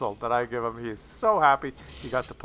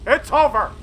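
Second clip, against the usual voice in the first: higher and louder, gleeful; excited call; 11 semitones higher, 6 dB louder than usual. Real, not acted. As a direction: announce the win with glee. Tank wins the fight.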